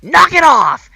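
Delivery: stern shout. Retold or imitated. Store employee scolding the fighting couple, loud stern shout. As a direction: bark it like a fed-up employee. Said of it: "she says".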